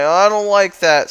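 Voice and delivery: playful high-voiced protest; mock displeasure. Retold objection of a character.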